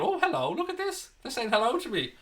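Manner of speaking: high-pitched pretend surprise; mock surprised onlooker. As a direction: act pleasantly startled in a high voice. Voices a surprised person born in 1995.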